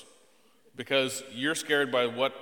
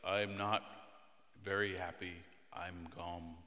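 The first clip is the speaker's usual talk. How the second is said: low, soft, dejected mumble; glum, boring; 7 semitones lower, 10 dB quieter than usual. Acted. Dad imitating someone challenging to be around, glum drone. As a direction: sink into an Eeyore-like gloom. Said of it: "kind of boring and challenging being around".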